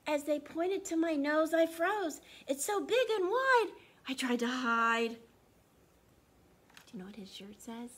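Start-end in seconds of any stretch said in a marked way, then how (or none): 0.1-5.1 louder, higher, dramatic read. Acted. Dramatic storybook lines, loud.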